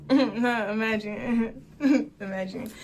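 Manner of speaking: laughing slowly through the word. Real, not acted. Laughing as she repeats her date's joke. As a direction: echo his line while laughing.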